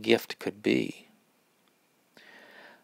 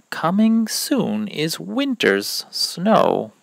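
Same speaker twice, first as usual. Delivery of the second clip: put-on bouncy, loud sing-song verse for children; sing-song rhyme for little ones; rhyme reading; 7 dB louder than usual. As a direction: read the poem out like a show for kids. Reads seasonal rhyme to kids.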